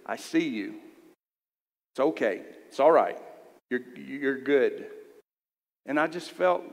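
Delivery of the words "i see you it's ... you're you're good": gentle reassurance. Retold or imitated. Little girl's message from God, low and tender. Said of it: "to say".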